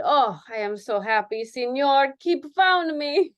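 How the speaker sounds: put-on Spanish accent. Dancer voiced with accent.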